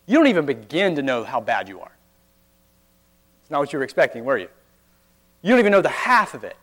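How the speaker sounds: ordinary talk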